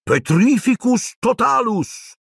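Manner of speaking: high, slow incantation; cried-out spell. A narrator's reading. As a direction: cast it high, drawing out the words. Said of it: "gridò puntandola contro Neville". (formula gridata, acuta e lenta)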